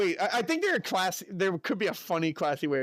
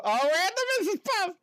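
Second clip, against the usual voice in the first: excited; 14 semitones higher, 3 dB louder, faster than usual. Real, not acted. Reading chat, excited about Mrs Puff.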